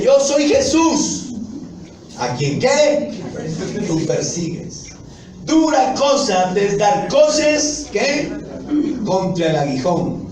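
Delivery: alto, lento y fuerte, solemne; voz del Señor. Acted. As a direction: proclama con autoridad divina, despacio. (Jesús responde a Pablo con voz alta y solemne)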